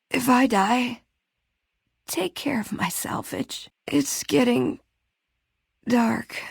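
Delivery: slow, fading, weak breath; dying words. Acted. Downed companion giving her last wishes to the player, slow and fading. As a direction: let the life drain out of each word.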